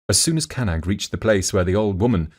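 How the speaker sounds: plain reading voice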